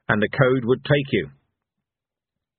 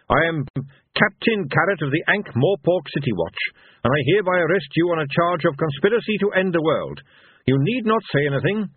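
higher voice, formal police officer; Captain Carrot; 7 semitones higher than usual. A narrator's reading. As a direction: recite the arrest officially, pitched up. Carrot arrests Cohen.

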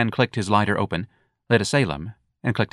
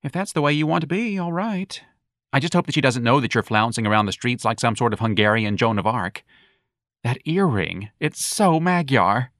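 put-on campy young man; 3 semitones higher than usual. Young man mocking the earring, high and campy.

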